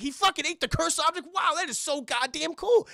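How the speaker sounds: high, loud gushing wow; fanboy thrilled at the news; amazed excitement. Retold or imitated. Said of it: "He's like".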